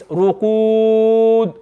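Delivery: slow ruqud, final d unreleased; without qalqala. Shown as a demonstration. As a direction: stretch ruqud and swallow the d. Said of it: "without qalqala".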